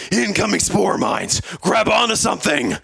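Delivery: high, acted battle shout; yelled warning. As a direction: warn the squad over roaring engines. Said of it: "yelled Regal over the roars".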